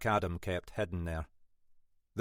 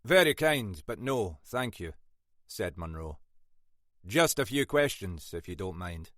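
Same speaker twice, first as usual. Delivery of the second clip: Scottish inspector. Acted. Munro voiced, Scottish.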